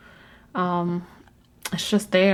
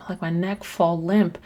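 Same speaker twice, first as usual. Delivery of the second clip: ordinary talk, nothing marked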